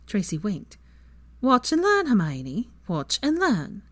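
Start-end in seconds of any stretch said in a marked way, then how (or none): 1.4-2.7 playful boast. Acted. Teasing boast to Hermione. 2.9-3.8 settled repeat. Acted.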